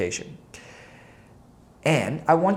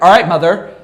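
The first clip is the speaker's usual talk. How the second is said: mock-sassy back talk to mom, louder; imitating a snotty kid answering back; bratty reply to mom; snippy teen comeback; 4 semitones higher, 12 dB louder than usual. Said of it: "My mom used to say it to me all the time".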